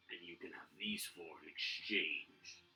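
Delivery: soft, hissed final offer; villain whispering the trade offer; menacing whisper. Acted. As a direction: drop to a threatening whisper. Villain's closing taunt.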